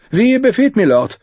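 high-pitched innkeeper voice; innkeeper character. A narrator's reading. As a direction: answer the lord in a high character voice.

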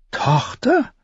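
lady shocked at the news; appalled. A narrator's reading. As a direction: gasp out the word in disbelief. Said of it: "fragte Caitlin entsetzt".

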